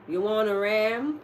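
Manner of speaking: slow, lightly put-on Texan drawl on "you wanna ram?"; a mild mock Texan accent, dragged out. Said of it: "Like a Texas Rangers".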